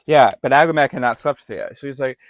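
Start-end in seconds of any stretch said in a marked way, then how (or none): none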